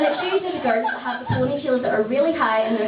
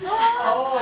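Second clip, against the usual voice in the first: shocked oh; 11 semitones higher than usual. Real, not acted.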